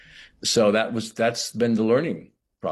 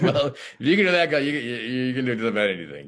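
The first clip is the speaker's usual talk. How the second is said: high, hammed-up self pep talk; mock pep talk; 5 semitones higher, faster than usual. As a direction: cheer yourself on like a coach. Psyching himself up, retold.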